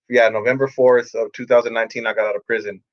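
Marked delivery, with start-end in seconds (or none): none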